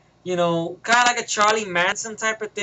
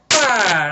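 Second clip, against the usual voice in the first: loud crash noise made by mouth; sudden loud impact sound; imitated crash; 6 dB louder than usual. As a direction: burst out a big "bah" for the impact. Imitating the collision into tank.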